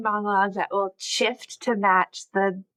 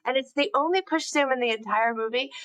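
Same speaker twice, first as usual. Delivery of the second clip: ordinary delivery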